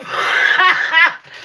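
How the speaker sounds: real high-pitched burst of laughter; helpless laugh. Real, not acted. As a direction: crack up at yourself. Laughing at imagined screaming.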